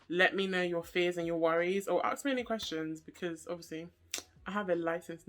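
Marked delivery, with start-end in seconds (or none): none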